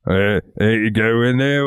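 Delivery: a lightly voiced character. Shown as a demonstration. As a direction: shift into another character voice. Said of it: "characters that talk like this".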